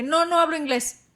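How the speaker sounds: mock-angry, emphatic denial. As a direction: act out refusing crossly. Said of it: "pero muy enojada".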